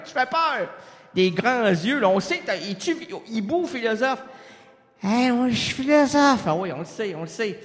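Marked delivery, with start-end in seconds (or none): 5.0-6.4 a touch of ghostly Pascal; dazed philosopher. Acted. Acted Pascal voice.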